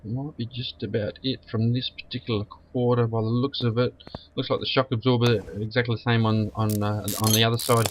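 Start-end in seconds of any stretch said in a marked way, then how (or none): none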